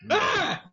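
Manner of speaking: high startled shriek; mock scream. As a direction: yelp like she did running off.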